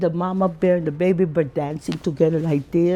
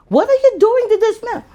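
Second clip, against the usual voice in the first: mock shocked parents; 13 semitones higher, 4 dB louder than usual. Parents scolding in retold story.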